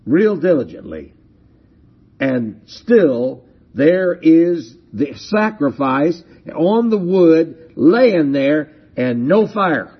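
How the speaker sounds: ordinary delivery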